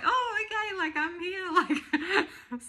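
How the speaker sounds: laughing quote. Retold or imitated. Pregnant woman faking cheer for her friend, high laughing. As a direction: fake a cheerful face through laughter. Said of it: "I'm like".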